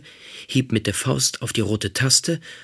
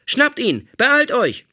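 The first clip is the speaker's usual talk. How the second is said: high, loud alarm cry; robot screams alarm; 6 dB louder, slower than usual. Acted. Robot captor raising the alarm to the others, loud high scream. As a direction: shout the alarm at full pitch. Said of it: "schrie er".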